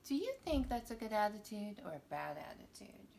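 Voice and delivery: fast, breathy whispered question. Acted. Asking young viewers about the attitude in a whisper. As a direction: whisper it to the kids.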